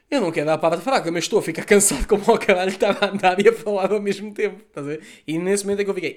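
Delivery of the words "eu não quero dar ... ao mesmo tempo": loud, breathless complaint, pitched higher; exhausted inner rant. Retold or imitated. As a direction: blurt it out like you're worn out from walking. Retold exhausted thought while walking. (desabafo alto e esbaforido)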